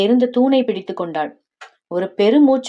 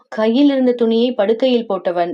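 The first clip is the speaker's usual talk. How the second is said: ordinary delivery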